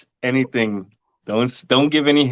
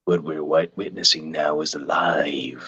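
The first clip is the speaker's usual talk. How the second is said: whispering; 9 semitones lower, 6 dB quieter than usual. Acted. Live commentary on a reaction.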